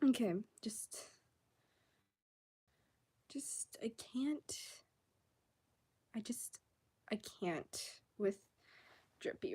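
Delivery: whispered, spent and fed up; exasperated whisper. Real, not acted. Venting about Drippy, an exasperated whisper. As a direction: breathe it out like you're at your limit.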